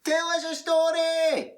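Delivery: high, loud clamouring demand; monkey's shout. Acted. Monkey calling to the newly arrived storyteller, shouting eagerly. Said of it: "One of the monkeys shouts".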